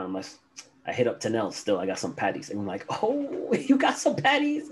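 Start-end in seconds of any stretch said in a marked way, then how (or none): 3.0-4.7 very high, delighted coworker squeal; mock excitement. Coworker hearing about the patties, mock-excited. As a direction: imitate someone thrilled, pitched way up.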